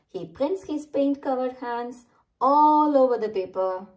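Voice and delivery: usual speaking voice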